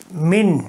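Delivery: من said min, short i then a closed ن; pronunciation demo. Shown as a demonstration. Teacher showing students the kasra reading, clear demo. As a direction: say من as min, cutting off on the n. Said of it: "یہاں کسرہ ہے".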